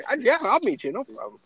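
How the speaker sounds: high imitated reply, dropping at the end; breezy quoted agreement, starting high; Khabib. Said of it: "His response was like".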